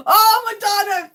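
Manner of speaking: high, loud delighted exclamation; excited cry. Real, not acted. Streamer cheering for [name], excited high shout.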